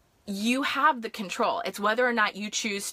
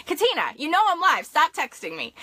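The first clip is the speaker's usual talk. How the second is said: laughing mock scold; 7 semitones higher, 5 dB louder than usual. Real, not acted. Scolds a friend texting during live.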